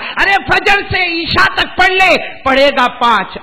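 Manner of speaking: high, loud preaching cry; shouted exhortation. Real, not acted. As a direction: urge the crowd on at full voice. Exhorting congregation. (پرجوش للکار)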